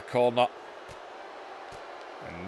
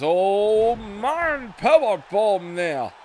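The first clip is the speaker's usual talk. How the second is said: excited wrestling commentator; 6 semitones higher, 4 dB louder, much slower than usual. Real, not acted. Big move during match call.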